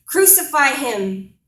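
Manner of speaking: loud, high, drawn-out shout; crowd's scream. Acted. Mob calling for Christ's death in a poem, screaming. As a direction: shout it out as a mob's demand. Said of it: "they're screaming in my face".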